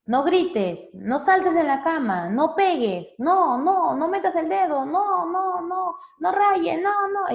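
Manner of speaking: rapid-fire parental nos, rising; shouting no. Shown as a demonstration. (gritos de padre regañando)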